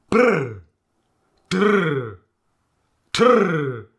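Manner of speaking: loud, long rolled R; rolled R trills. Shown as a demonstration. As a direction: trill hard after the consonant. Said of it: "The burr".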